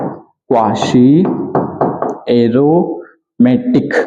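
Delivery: Quasi aromatic drawn out very slowly, word by word; slow, spelled-out name. Shown as a demonstration. Teacher dictating a name to students, very slow. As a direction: stretch quasi then pause, then aromatic, slowly for students to write. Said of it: "क्या बोलेंगे इसको?".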